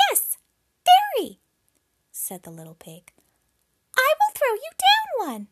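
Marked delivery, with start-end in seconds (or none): 0.1-1.4 very high, loud little pig. Acted. Pig answers the wolf. 4.0-5.5 very high, loud little pig. Acted. Pig answers the wolf.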